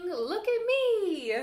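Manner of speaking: playful look-at-me shout; excited call. Acted. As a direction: show off like a kid in the sky. Kite calls out in story.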